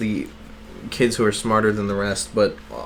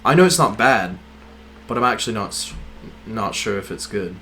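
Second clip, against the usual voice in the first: British accent; 2 semitones lower than usual. Shown as a demonstration. Podcaster unsure if it's good, attempted British accent. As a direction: speak in a tentative British accent. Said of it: "this is actually a really good British accent".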